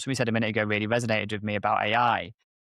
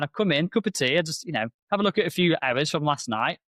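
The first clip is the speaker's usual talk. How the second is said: ordinary talk, nothing marked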